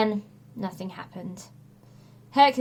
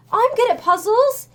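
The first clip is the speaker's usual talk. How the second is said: put-on quick eager boy's reply, higher; hastily; 12 semitones higher, 7 dB louder than usual. A narrator's reading. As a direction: rush to stop him crying. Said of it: "Tim said hastily".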